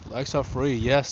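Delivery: ordinary delivery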